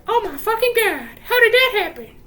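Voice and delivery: very high mock-horrified crowd voice; hammed-up squeal of alarm; shocked onlookers. Onlookers reacting to the accident, in a high, loud mock shock. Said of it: "And everybody's like".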